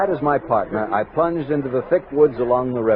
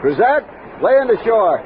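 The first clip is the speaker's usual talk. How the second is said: raised call across the water; 8 semitones higher, 3 dB louder than usual. Acted. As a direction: call the boatman in, higher and louder. Calling to boatman Cruzat.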